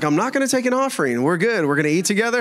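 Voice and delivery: high, hurried mock placating; playful hasty reassurance; joking reassurance. Retold hasty reassurance to startled woman.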